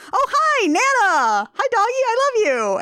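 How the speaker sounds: high-pitched gushing to a beloved dog; gushing dog greeting. Acted. Peter greeting Nana the dog, high and gushing. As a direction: coo and gush at the doggy.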